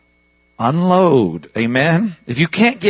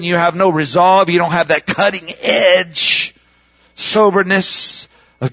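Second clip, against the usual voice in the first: a hint of raised, urgent preaching pitch; fervent preaching; 5 semitones higher than usual. Preacher warning the congregation, urgently raised. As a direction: press the warning home with rising fervour.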